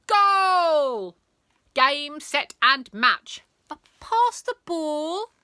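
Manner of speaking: high, loud playground calls; noisy kids shouting. Acted. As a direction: yell like kids mid-game. Kids playing noisily.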